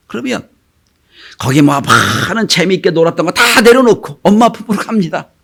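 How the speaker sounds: ordinary delivery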